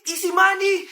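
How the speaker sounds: normal talking voice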